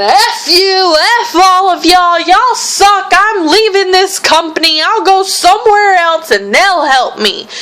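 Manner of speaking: hammed-up screaming tirade; imitating her screaming. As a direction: rant like the furious woman storming off. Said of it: "She just starts screaming".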